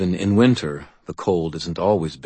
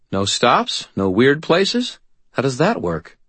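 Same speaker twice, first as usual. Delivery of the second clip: higher, puzzled woman's voice; incredulous questions; Murphy; 8 semitones higher, 3 dB louder, slower than usual. Acted.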